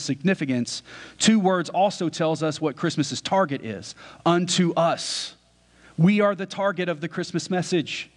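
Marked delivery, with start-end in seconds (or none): 4.3-5.4 unto us said very slowly, each word leaned on; slow stress. Acted. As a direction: drag out unto us for weight. Quoted key words isolated.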